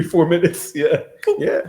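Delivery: usual speaking voice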